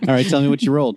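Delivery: laughing high through words. Real, not acted. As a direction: let the laugh carry it. Laughing while talking.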